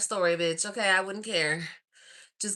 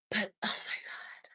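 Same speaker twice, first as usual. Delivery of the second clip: whisper; 9 dB quieter than usual. Real, not acted. Gushing secret to viewers.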